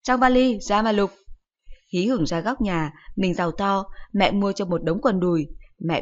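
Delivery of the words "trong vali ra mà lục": higher, louder brush-off; curt mother. Acted. Mother answers curtly.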